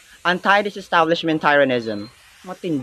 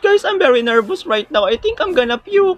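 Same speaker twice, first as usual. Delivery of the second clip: put-on high, jittery girl voice; nervous girl pitched high before the quiz; nervous schoolgirl. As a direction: squeak it out, scared of the contest.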